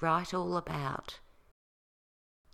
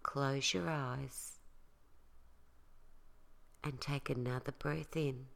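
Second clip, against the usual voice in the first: soft spa whisper; 2 semitones lower, 7 dB quieter than usual. Acted. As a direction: talk them gently into calm, softer. Leads a guided relaxation.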